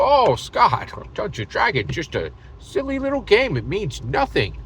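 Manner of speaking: high, mocking sing-song put-down; high scoffing voice of a doubter; mock dismissive voice. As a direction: sneer it like someone brushing him off. Mocking imagined dismissive person.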